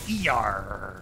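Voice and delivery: slow drawn-out pirate "E R"; pirate "arr" punchline. Pretend. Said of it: "Where do the pirates go".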